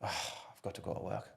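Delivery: weary parent groaning. Retold or imitated. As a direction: sigh it out like a parent dreading work.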